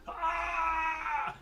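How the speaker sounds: high, drawn-out scream; acted scream. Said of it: "and he screams".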